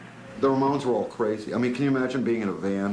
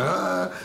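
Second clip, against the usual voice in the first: angry yell; 10 semitones higher than usual. Retold or imitated. Dee Dee freaking out at Johnny, angry yell. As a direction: let out a furious cry. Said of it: "Dee Dee just, freaking out, going".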